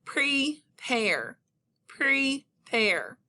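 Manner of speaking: prepare said slowly, pre then pare split apart; teacher models prepare by syllables; syllable tapping. Shown as a demonstration. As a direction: model prepare so each syllable hits the chin. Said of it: "let's try that again".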